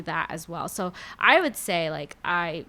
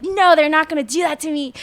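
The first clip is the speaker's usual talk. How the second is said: louder, higher defiant retort; sassy comeback; 6 semitones higher, 7 dB louder than usual. Retold or imitated. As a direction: voice the sassy side standing up for herself. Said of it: "part of me that's like".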